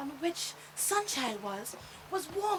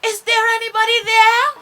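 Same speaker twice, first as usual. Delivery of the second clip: loud, high call into the darkness; 7 semitones higher, 18 dB louder than usual. A narrator's reading. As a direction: call out across the sky. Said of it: "And she called out".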